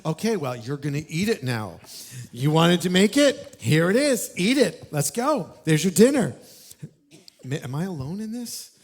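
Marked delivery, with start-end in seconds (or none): 0.0-6.3 hammed-up sarcasm building to a shout; sarcastic parent. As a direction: parent making a kid eat the mess, sarcastic. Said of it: "tempted to be like".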